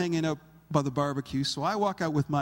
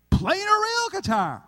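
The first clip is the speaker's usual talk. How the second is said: much higher and louder, triumphant; emphatic high shout; 14 semitones higher, 5 dB louder than usual. Real, not acted. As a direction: land the punchline with a high ringing shout. Punchline shouted to congregation.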